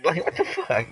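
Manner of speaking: ordinary talk, nothing marked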